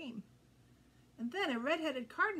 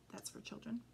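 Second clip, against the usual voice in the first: soft whispered explanation; whispered aside; 6 dB quieter than usual. Real, not acted. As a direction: lean in and whisper the gloss.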